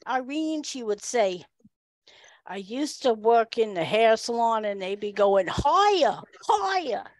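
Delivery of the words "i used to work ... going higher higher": imitated New York accent, climbing on the quote; hammed-up Long Islander, pitched up on the repeated word. Woman describing her salon job, Long Island accent. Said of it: "That's how she described her job".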